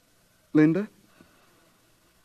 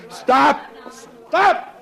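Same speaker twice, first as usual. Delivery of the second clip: loud, much higher yell; desperate shout; shouted command; 11 semitones higher, 4 dB louder than usual. Acted. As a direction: stop them, shouting. John shouting at crowd.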